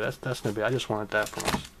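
normal talking voice

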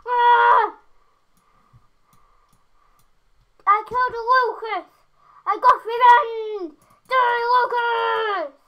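loud, slow gloating yells; triumphant shouts. Real, not acted. Gamer gloating after killing his rival Lucas, loud triumphant shouting. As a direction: crow over the kill at full volume.

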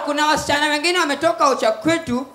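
ordinary talk

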